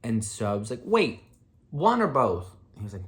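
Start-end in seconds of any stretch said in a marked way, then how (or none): 0.8-2.5 high and loud, jumping at a way out; urgent hopeful question. Retold or imitated. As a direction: blurt it out, suddenly hopeful. Hopeful question about test rule.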